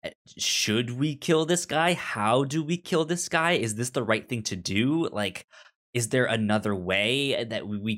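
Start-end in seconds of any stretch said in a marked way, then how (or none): none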